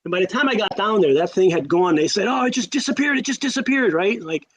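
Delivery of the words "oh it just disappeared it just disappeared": higher-pitched imitation of excited friends; retold excited report. Friends telling him the thing had gone, retold excitement. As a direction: lightly act out breathless teenage news, pitched up.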